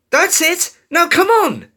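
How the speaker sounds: high, loud cheer of encouragement; excited rallying cry. A narrator's reading. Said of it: "Dash cheered".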